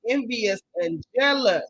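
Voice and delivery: slow, deliberate "envious and jealous"; unhurried jab; slow name-calling. Acted. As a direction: spell out the insult slowly.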